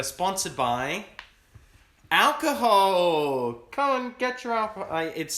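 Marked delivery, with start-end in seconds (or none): 0.0-3.5 slow build, then a loud drawn-out "alcohol"; drawn-out mock reveal. Mock sponsor announcement. 3.7-4.9 high, hammed-up salesman call; mock ad pitch.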